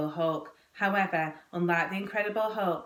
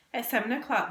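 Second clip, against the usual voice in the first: plain reading voice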